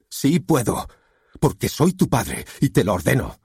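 emphatic angry order; commanding father. A narrator's reading.

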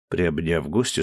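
plain reading voice

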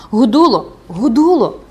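loud, high cry of a name; urgent calling out. Acted. Woman fearing the recluse is dead, urgent calling out. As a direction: call the name desperately to rouse her.

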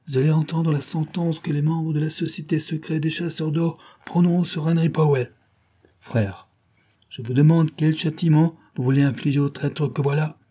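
lightly voiced solemn gang boss; gang leader passing sentence. A narrator's reading. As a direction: address the members like a judge.

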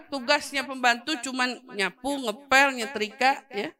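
ordinary talk

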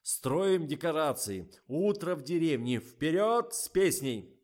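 loud, slow, high rallying call; rallying cry. A narrator's reading. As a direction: fire up the crew. Director rallying the crew.

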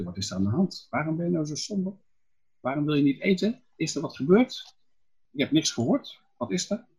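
quick, prying wife's questions; concerned queen. Acted.

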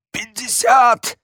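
very high, drawn-out squeal; choked squeak. A narrator's reading. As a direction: squeak it out while being strangled. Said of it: "пискнул Редж".

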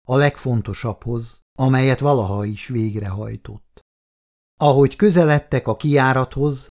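ordinary delivery

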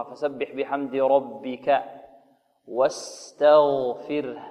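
slow drawn-out recitation; Quran recitation. Shown as a demonstration. Teacher recites the verse, slow chant. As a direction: chant the phrase slowly.

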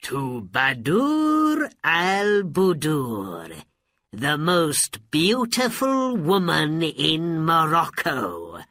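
slow, pleased toast; happy magician. Acted. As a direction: raise a gloating toast. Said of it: "Abanazar said happily".

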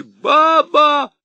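loud high shout. A narrator's reading. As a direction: yell at the top of your lungs. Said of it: "он крикнул изо всей мочи".

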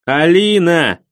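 loud angry shout of a name; yelling. Acted. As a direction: bellow her name. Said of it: "заорал я".

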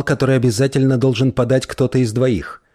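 ordinary delivery

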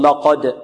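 لقد with a thick, dark ل before the ق; wrong, heavy lam. Shown as a demonstration. Teacher quotes some reciters' error, heavy lam. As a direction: let the ق darken the ل of لقد. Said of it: "يفخم اللام".

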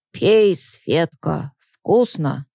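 put-on very slow slurred drunk voice. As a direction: slur it like a drunkard. Drunk man offers egg.

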